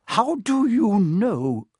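high, drawn-out disbelief; stunned professor demanding an answer; slow, aghast question; shocked splutter. A narrator's reading. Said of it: "she spluttered".

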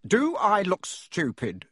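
mild snarl. Acted. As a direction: uncle snarls at nephew. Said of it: "snarled Uncle Vernon".

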